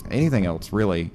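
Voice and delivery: usual speaking voice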